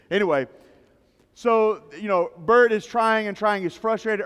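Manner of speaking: ordinary talk, nothing marked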